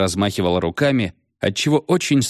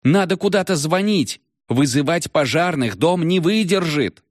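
alarmed; 9 semitones higher than usual. Acted. Panicked shout about the house.

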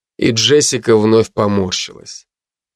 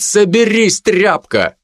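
shout; 9 semitones higher, slower than usual. Acted. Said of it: "выкрикнуть".

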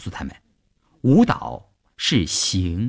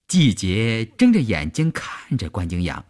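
usual speaking voice

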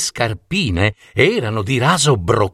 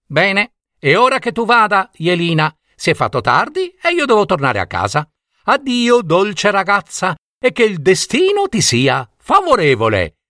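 high, hurried goodbye; fox doorkeeper farewell; 10 semitones higher than usual. A narrator's reading. Tatanaia saying goodbye to Jelina, high and hurried. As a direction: send her off quickly, kindly.

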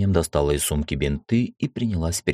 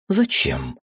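biting, pitched-up sarcasm; sarcastic; 9 semitones higher than usual. A narrator's reading. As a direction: needle him with it. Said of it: "язвительно спросила Адна".

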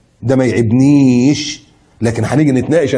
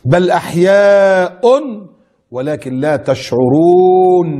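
slow recitation; 3 semitones higher, much slower than usual. Acted. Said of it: "لا، لا، لا، لا".

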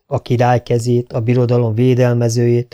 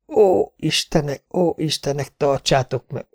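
hushed, higher-pitched pleading to the gods; horrified prayer; 4 semitones higher, 3 dB quieter than usual. A narrator's reading. Onlooker sees the wound and prays, softly horrified.

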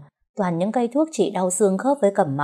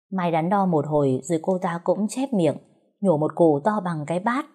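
ordinary delivery

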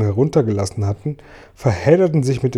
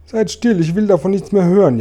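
high, louder wail of distress; 9 semitones higher, 5 dB louder than usual. A narrator's reading. Blind earthworm can bear no more, wailing. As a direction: wail it, begging not to hear. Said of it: "jammerte der arme blinde Regenwurm".

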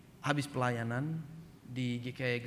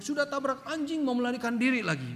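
high-pitched indignant thug; imitated angry accusation; 9 semitones higher, 3 dB louder than usual. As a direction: accuse the driver, voice pitched right up. Gang accuses him of fleeing. (meniru tuduhan marah bernada tinggi)

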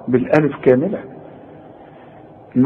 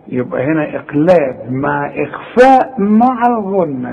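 ordinary talk, nothing marked

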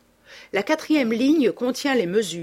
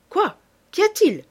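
high, louder burst of alarm; startled questioning; 11 semitones higher, 5 dB louder than usual. A narrator's reading. Companion asks why the reading stopped, startled questioning. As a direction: ask it sharply, pitch jumping up. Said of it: "fit Massiban".

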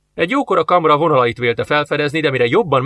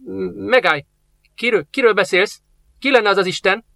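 higher, stammering fright; old master startled and scared, pitched up; frightened; 7 semitones higher than usual. Acted. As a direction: stumble over the words in sudden fear. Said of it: "ijedt meg Benipe".

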